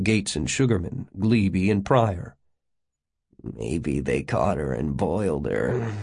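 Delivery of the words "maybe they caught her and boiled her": low, sly giggling voice; softly amused, pitched well down. A narrator's reading. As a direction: say it low and soft, giggling at a morbid joke. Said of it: "Gates giggled".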